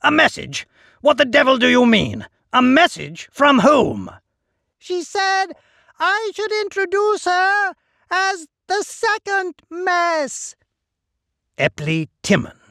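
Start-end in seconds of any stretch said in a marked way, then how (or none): 0.0-4.1 impatient demand. A narrator's reading. 4.8-10.5 high, whiny little servant calling; a reedy, pleading little voice calling out. A narrator's reading. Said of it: "I can just hear his whiny voice".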